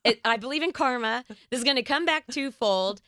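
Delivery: earnest insistence. Retold or imitated. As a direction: convince him, rising with conviction. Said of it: "And I said".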